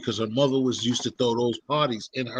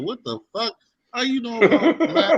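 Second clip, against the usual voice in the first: shocked quote; 8 semitones higher, 5 dB louder than usual. Retold or imitated. Retelling a shocked reaction.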